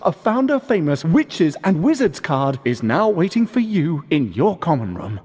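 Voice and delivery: ordinary talk, nothing marked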